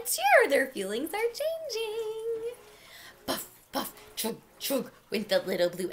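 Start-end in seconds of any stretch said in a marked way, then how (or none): 0.1-2.5 high, gleeful storytelling; bright rise into a singsong cheer. A narrator's reading. Toys begin to cheer. 3.3-4.9 soft breathy train noises; quiet, spaced chugging sounds; engine puffing. A narrator's reading.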